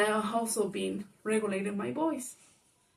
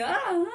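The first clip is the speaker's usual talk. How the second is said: frustrated groan; 10 semitones higher, 5 dB louder than usual. Retold or imitated. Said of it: "she also sleeps, like".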